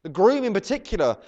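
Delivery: ordinary talk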